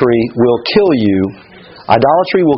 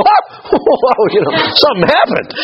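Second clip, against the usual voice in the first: high-pitched laughing amazement; mock astonishment; 10 semitones higher, slower than usual. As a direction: gasp as if stunned the prayer worked. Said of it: "when something actually happens, we go".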